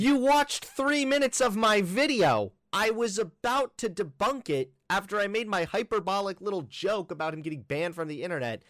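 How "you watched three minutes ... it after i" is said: loud, high, slow protest; raised-voice exasperation at a critic; indignant retort. Real, not acted. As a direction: hit back loudly, drawing out each word. Snaps back at video critic.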